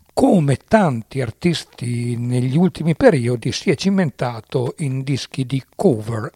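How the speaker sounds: usual speaking voice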